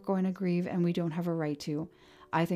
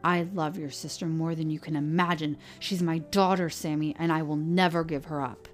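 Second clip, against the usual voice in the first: louder, angry fatherly rebuke; shaking with anger; 6 dB louder than usual. Acted. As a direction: hold back rising anger. Sheriff angrily defends himself.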